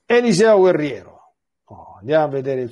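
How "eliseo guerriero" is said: high, loud announcement; announcing title. Real, not acted. Priest announcing the next story to his listeners, excited. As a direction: proclaim the chapter title with flair.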